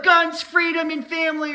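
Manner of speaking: "guns, freedom, and family" belted high and loud like a cheering patriot; mocking patriot cheer. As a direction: proclaim it as a proud cartoon patriot. Mocking patriotic gun lover.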